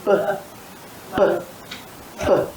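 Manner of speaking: short popped b sounds; letter sound b. Shown as a demonstration. Teacher modelling the b sound for a child. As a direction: pop the lips for each b. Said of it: "it says".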